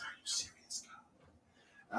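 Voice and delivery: unvoiced whisper to God; whispered plea. Called to the altar, whispering to God. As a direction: whisper it, desperate. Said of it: "I was like".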